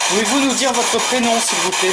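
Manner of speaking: calling out. Real, not acted. Loudly asking the spirit's name.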